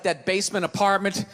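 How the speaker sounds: normal talking voice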